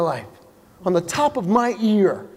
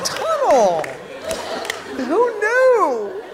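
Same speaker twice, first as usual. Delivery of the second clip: mock amazement; 9 semitones higher, much slower than usual. Comic mocking the sign with high fake amazement. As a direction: marvel at it like a discovery.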